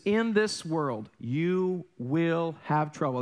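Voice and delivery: In this world, you will have trouble drawn out very slowly; slow reverent quote; slow solemn scripture. Shown as a demonstration. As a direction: read the verse slowly and weightily. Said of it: "He says".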